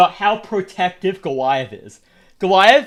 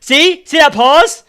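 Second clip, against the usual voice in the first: exasperated; 8 semitones higher, 8 dB louder, slower than usual. Real, not acted. Annoyed at slow game text.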